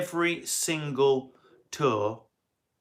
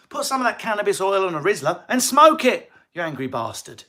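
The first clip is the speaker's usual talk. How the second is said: loud, higher mock rant; 7 semitones higher, 7 dB louder than usual. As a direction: shout it at the commenter. Ranting at a commenter.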